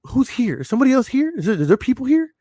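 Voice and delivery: suddenly spooked, scanning the room; retold panicked questions; alarmed. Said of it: "So I'm like".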